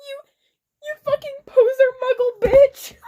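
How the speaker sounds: high, loud, laughing shout. Acted. Draco yells at her from behind a wall, laughing. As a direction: yell it at her, half laughing. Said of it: "He shouted".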